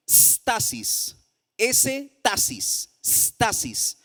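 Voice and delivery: "stasis" said slowly with a hissed initial S; Greek root pronounced. Shown as a demonstration. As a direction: spell out "S tasis", then say "stasis" whole. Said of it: "Y la otra raíz es". ("stasis" pronunciado despacio, separando la S)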